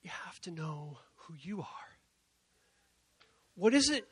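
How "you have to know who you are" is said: hushed earnest whisper. Real, not acted. Preacher telling the audience a secret, whispered. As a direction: lean in and whisper it. Intimate emphasis point.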